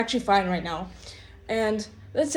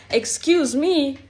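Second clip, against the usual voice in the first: indignant; 5 semitones higher, 4 dB louder, slower than usual. Real, not acted. Indignant about grandma's excuse.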